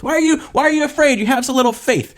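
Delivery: high-pitched, quick mock rebuke; mock scolding. Jesus to frightened disciples, high mock scolding.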